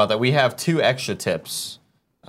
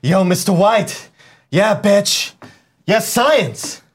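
high, loud, hyped-up exclamations; Jesse Pinkman; 7 semitones higher, 6 dB louder, slower than usual. Acted. Doing a requested TV character impression, loud and hyped. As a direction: yell it in short excited bursts. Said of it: "Give me Jesse Pinkman".

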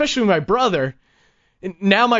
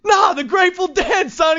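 hustler shouting to Tony; 13 semitones higher than usual. Retold or imitated. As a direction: yell across the boulevard to your buddy.

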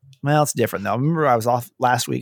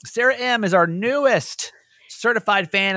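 higher, slow hype announcement; hype announcer; 7 semitones higher, slower than usual. Acted. Host hyping up a new certified fan on air, slow and high. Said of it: "here is your official".

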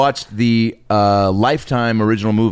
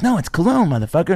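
put-on angry kid; 8 semitones higher than usual. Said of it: "why are you so angry".

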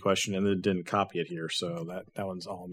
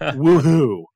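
mock cheer; 4 semitones higher, 7 dB louder than usual. Mock cheer at parentheticals in script.

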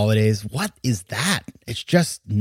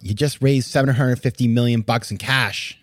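ordinary delivery

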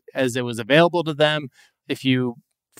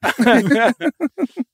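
dopey cartoon bellow; 12 semitones higher, 6 dB louder than usual. Shown as a demonstration. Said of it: "I do use a dumb guy voice".

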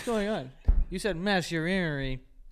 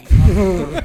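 laughing; 19 dB louder than usual. Real, not acted. Laughing at friend.

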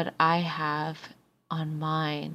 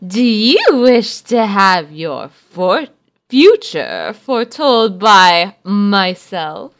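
fortune teller; 7 dB louder than usual. Acted. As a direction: call out like a mystic crone. Old woman fortune teller calls out.

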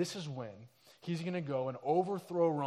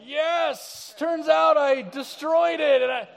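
much higher and louder, slow gleeful boast; Jesus bursting with the punchline; triumphant shout; 9 semitones higher, 14 dB louder, slower than usual. Retold or imitated. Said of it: "He's like".

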